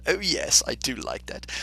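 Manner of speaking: usual speaking voice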